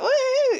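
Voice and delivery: high, loud drawn-out "woo"; mock party whoop. Mocking her parents' idea of her wildness, high whoop. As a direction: let out a sarcastic wild whoop. Mimics a wild coked-up whoop.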